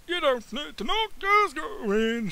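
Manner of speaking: high, slow put-on receptionist voice; sweet high receptionist welcoming her in.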